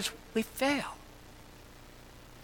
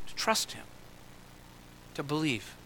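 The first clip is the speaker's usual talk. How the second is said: whispered; 4 semitones lower, 12 dB quieter than usual. Acted. Hushed sermon close.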